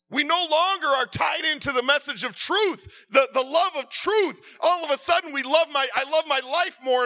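loud, high, impassioned sermon; a preacher crying out over wounded pride; fiery preaching. Acted. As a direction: preach it at full force, pleading. Preaching builds to full force.

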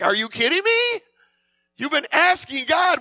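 high-pitched incredulous outburst; exasperated disbelief. Retold or imitated. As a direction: imitate someone who can't believe their ears. Mock-exasperated reply to doubting believers.

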